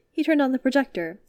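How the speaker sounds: plain reading voice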